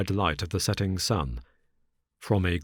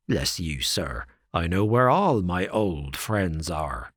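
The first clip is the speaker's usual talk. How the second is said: sinister smile; slower than usual. Acted. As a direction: smile the menace out slowly. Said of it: "said the fellow with a sinister smile".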